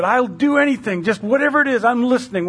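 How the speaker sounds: high, urgent, willing voice; eager pleading. Acted. Hiker offering God anything to be saved, eager pleading.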